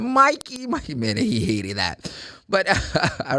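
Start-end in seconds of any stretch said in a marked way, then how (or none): 0.0-0.9 high-pitched call to a dog. Retold or imitated. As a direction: imitate someone calling their dog. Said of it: "the girl was calling a dog".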